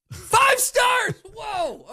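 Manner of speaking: loud, high, stunned question; can't believe what he heard; shocked disbelief. Real, not acted. Shocked at the five-star answer.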